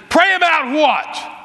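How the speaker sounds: high, drawn-out indignant yell; retold yell. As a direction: yell it back in disbelief, stretching the words. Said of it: "I yelled at him".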